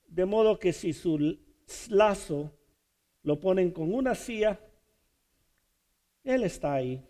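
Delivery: normal talking voice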